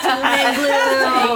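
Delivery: slow, drawn-out gloomy voiceover; ominous doom voice. Pretend. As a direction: intone it like a doom announcer. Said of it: "voice thing that's, like, when we're talking about doom and gloom".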